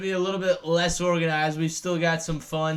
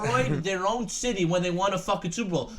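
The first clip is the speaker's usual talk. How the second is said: ordinary delivery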